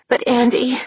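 breathless, hurting plea; panting in pain. Acted. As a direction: push it out through the pain. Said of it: "panting from the pain".

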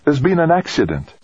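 high-pitched, urgent report; almost breathless alarm. A narrator's reading. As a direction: break bad news, almost out of breath. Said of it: "she called, almost breathless".

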